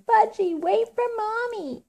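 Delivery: high, carrying holler; mother hollering. A narrator's reading. Mother running behind her little son, high-pitched hollering. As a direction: call out after a runaway child in a high voice.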